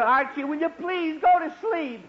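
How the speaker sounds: father begging his son to sleep; exasperated plea. Acted. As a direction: plead at the end of your rope. Father loses patience with son.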